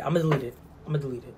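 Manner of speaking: very quiet, low trailing off. Acted. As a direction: fade almost to nothing. Repeated, fading to whisper.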